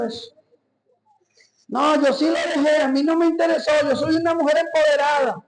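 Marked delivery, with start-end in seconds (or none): 1.7-5.4 mock empowered woman. Mock neighbor boasting to preacher.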